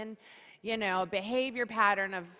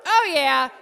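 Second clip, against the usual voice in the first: loud hyped oh yeah; energized whoop; 4 semitones higher, 11 dB louder than usual. Real, not acted. Host rallying the crowd, loud excited cheer. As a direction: pump up the room.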